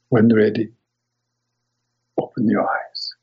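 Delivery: calm guiding voice, unhurried. Acted. Leads guided imagery meditation.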